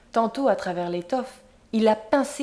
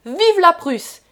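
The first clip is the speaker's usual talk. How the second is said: put-on high, loud roar of a drunk crowd; loud drunken cheer; vociferating; 8 semitones higher, 8 dB louder, slower than usual. A narrator's reading. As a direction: roar the toast like brutes. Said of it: "en vociférant".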